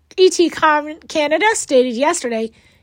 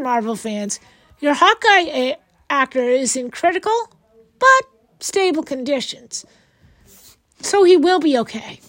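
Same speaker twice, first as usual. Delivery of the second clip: usual speaking voice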